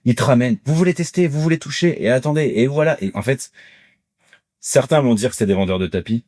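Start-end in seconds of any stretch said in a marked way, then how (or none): 0.7-3.0 high, inviting stallholder patter; market demonstrator. Retold or imitated. Vendor inviting the public to test and touch, high patter. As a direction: pull shoppers in like a fairground seller. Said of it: "ils t'interpellent dans le public".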